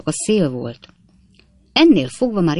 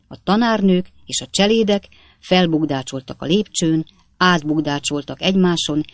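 ordinary delivery

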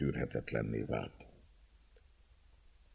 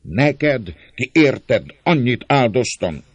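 loud, high-pitched indignation; impassioned reproach to a brother; trembling with passion; 8 semitones higher, 8 dB louder, slower than usual. A narrator's reading. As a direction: cry out in hurt anger. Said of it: "Szólt indulatában remegő hangon".

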